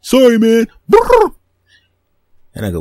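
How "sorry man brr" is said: high, loud chirping bird trills. Acted. Bird chirping an apology over the car.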